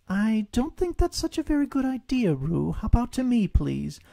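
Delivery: high motherly coaxing; gentle Kanga. A narrator's reading. Kanga coaxes Roo out, motherly. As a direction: coax him out like a mother.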